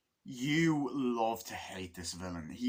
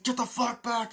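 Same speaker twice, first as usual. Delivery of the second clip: high, urgent shout; heated mock command; acted angry order; 11 semitones higher, 4 dB louder than usual. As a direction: imitate a soldier warning people off. Acting armed standoff in film.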